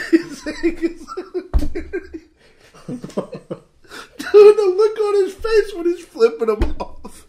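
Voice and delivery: very high, squealing through laughter; helpless laughter. Real, not acted. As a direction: barely get the words out for laughing. Helpless laughter recalling the scene.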